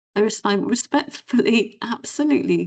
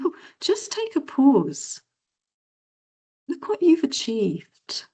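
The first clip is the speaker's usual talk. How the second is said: high, slow and encouraging; slow her down and lift her up; caring coach; 7 semitones higher, slower than usual. Retold or imitated. Coach telling a client to pause, high slow caring voice. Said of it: "And I said".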